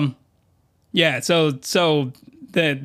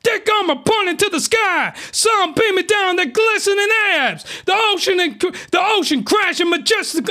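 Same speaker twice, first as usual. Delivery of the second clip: pissed-off rant; 13 semitones higher than usual. Real, not acted. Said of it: "Because now I'm pissed".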